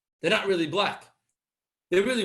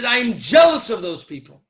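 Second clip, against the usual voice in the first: much louder acted declaration; emphatic retold confession; 10 dB louder than usual. Wife confessing jealousy to her husband, emphatically. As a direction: burst out with envy in the wife's words.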